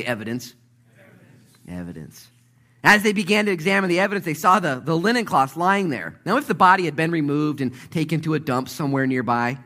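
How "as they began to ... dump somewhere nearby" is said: loud, high, driving sermon delivery; full-force preaching. Acted. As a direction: preach it out, building fast.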